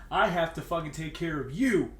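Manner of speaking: ordinary talk, nothing marked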